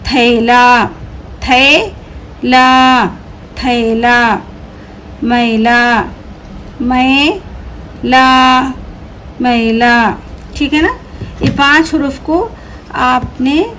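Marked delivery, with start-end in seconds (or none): 0.1-6.0 very slow, syllable by syllable; slow drawn-out reading. Shown as a demonstration. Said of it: "teacher stretches the word for pupils". 6.8-10.2 very slow, syllable by syllable; slow drawn-out reading. Shown as a demonstration. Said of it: "teacher stretches the word for pupils".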